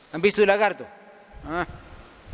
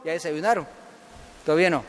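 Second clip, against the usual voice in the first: ordinary delivery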